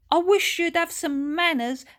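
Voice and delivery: high, loud mock shout at the boy; retold shout. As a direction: yell it up high as she remembers it. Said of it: "and I shouted".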